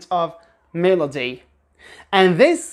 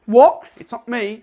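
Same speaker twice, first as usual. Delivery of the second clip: sharp, louder What with a glottal T, British accent; 4 semitones higher, 7 dB louder than usual. Shown as a demonstration. Teacher acts a surprised British speaker with glottal T. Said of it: "sometimes with the glottal T".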